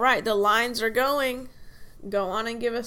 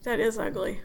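ordinary talk, nothing marked

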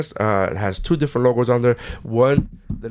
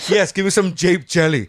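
normal talking voice

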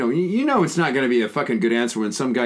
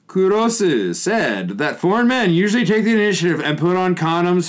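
higher, projected announcer-like reading; article read-aloud; 6 semitones higher, 4 dB louder than usual. Real, not acted. Host reading an article to listeners, projected read-aloud. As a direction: read it out emphatically, drawing out phrase ends.

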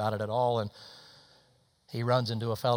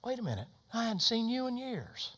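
surprised recognition; 11 semitones higher than usual. Retold or imitated. Waitress recognizes an old acquaintance, surprised. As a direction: pitch swooping up in mock astonishment.

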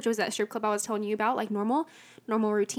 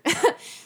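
short laugh; 7 semitones higher, 5 dB louder than usual. Real, not acted. Laugh after calling mom.

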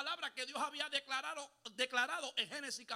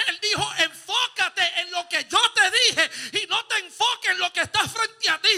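booming preacher voice, very loud; preaching at full force; 3 semitones higher, 16 dB louder than usual. Acted. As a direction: thunder God's word at the congregation. Voicing God at full force.

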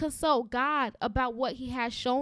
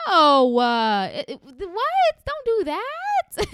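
high, loud and drawn out; shocked loud protest, slow and high; loud slowed outburst; 6 semitones higher, 10 dB louder, much slower than usual. Real, not acted. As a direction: cry out in disbelief, stretching the words. Outburst at insulting God.

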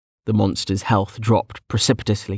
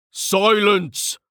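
raised-pitch commanding cry; roared command; 10 semitones higher than usual. A narrator's reading. Senior fire mage silencing the arguing youngster, roar. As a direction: cut him off with a high-raised order. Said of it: "Vink roared".

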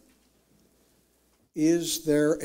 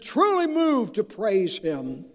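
high, ringing preacher's cry; impassioned preaching; 8 semitones higher than usual. Real, not acted. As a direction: preach it with rising fervour, high-pitched.

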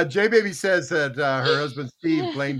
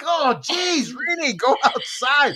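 high, drawn-out mock complaint; exasperated groan; 8 semitones higher, slower than usual. Owner to the farting family dog, exasperated. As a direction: order the smelly dog out, exasperated.

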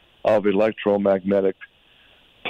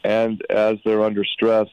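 usual speaking voice

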